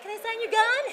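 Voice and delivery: high, laughing ask; giggly question. Retold or imitated. I'd be like.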